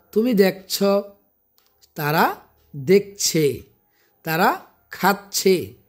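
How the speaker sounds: slow, careful endings on দেখছি, যাচ্ছি, খাচ্ছে, শিখছে; pronunciation drill. Shown as a demonstration. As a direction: model each ending clearly for learners. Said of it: "উচ্চারণগুলো একটু খেয়াল রাখবেন". (উচ্চারণ শেখানোর মতো স্পষ্ট করে)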